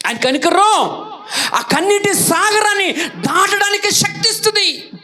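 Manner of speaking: impassioned preacher proclaiming mercy; full-force preaching. Acted. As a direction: preach it out to the crowd with rising fire. Preaching climax on God's mercy.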